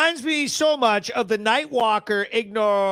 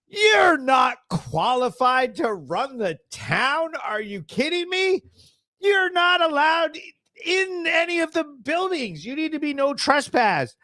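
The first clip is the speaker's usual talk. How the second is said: angry rant; 4 semitones higher than usual. Real, not acted. Ranting about the woman at the select board, shouting. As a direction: rail at her, spelling out each charge.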